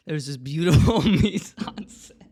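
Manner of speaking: giggling through it; laughing. Real, not acted. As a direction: laugh breaking through words. Laughs mid line.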